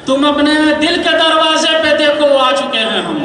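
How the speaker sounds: measured, declaiming verse to a crowd; stately nazm recitation. Acted. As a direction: recite each line with weight and pauses. Poet recites quatrain.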